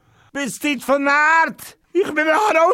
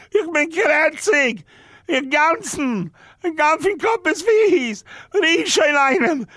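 ordinary talk

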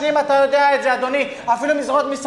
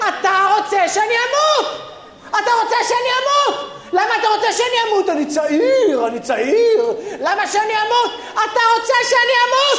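high, panicked comic yelling; hysterical shouting; 7 semitones higher than usual. Acted. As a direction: overreact like you're dying. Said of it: "מה אתה צועק?". (צעקות היסטריות בקול גבוה)

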